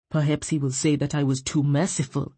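low, contemptuous reply; thick contempt. A narrator's reading. Chief officer to defiant woman, voice thick with contempt. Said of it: "voice thick with contempt".

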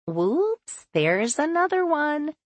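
bright high cheery nurse; cheerful nurse voice. Acted. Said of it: "says cheerfully".

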